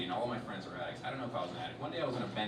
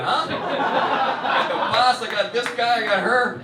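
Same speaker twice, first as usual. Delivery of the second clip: loud teasing roll call; 9 semitones higher, 11 dB louder, slower than usual. Real, not acted. Pointing out audience members.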